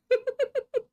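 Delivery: sudden high laugh; real laugh breaking out; high burst of laughter. Real, not acted. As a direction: crack up in a squeal.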